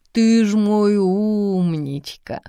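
slow tender cooing; cooing praise. Acted. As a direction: praise a baby lovingly. Grandma praises the baby.

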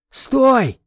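sudden loud high yell; loud, high shout of alarm; yelling stop. A narrator's reading. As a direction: shout to halt the beast. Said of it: "заорал я".

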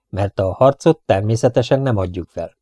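plain reading voice

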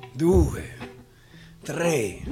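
ordinary delivery

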